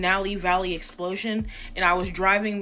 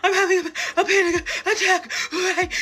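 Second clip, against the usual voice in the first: mock panicked plea; 11 semitones higher, 4 dB louder than usual. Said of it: "And I was like".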